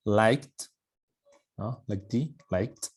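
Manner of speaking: Liked ending in a clear t; correct liked. Shown as a demonstration. Teacher correcting the students' liked. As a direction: stretch Liked out slowly with a t ending. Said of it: "hay que tener cuidado".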